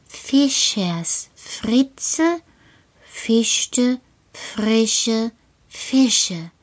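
very slow, every syllable crisp; over-articulate "Fischers Fritze" slowly; very clear speech. Shown as a demonstration. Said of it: "Noch einmal".

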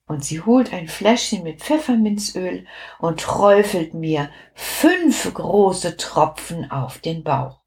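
ordinary delivery

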